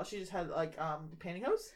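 normal talking voice

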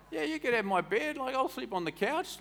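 high, cheery and welcoming; generous coast local. Retold or imitated. Preacher voicing friendly coast folk offering a bed, high and cheery. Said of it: "We'd be like".

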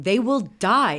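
retold outcry. Viewer protesting fish put in the river, loud mock outcry. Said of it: "which I said out loud".

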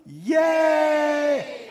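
very high, sustained yay; happy cheer. Shown as a demonstration. Preacher practising the happy response with the crowd, happy cheer. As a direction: stretch it into one long high cheer. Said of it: "let's practice together".

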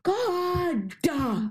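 put-on high whiny exasperated kid. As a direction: groan it like an annoyed child. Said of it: "when you're a kid and you're like".

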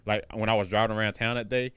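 ordinary talk

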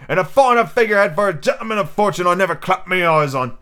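pirate cook, hearty. Acted. Silver welcomes the lad into the crew, hearty. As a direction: praise the lad with pirate gusto.

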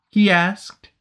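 ordinary delivery

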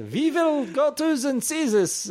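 high, slow mock German accent; fake German accent. Meant as a Finn, landing in a mock German accent. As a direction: ham up a German businessman. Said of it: "very German accent".

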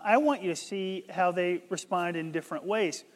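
usual speaking voice

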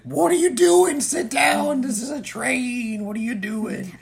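loud, high-pitched frantic yell; scolding teacher. Acted. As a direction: shriek it like a panicked teacher. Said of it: "then Mister Yamanaka's like".

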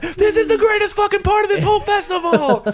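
mock ecstatic fans. Crowd recognizing Back in the High Life, high squealing mock joy. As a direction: squeal it like a thrilled drunk fan.

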